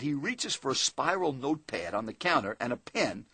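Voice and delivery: usual speaking voice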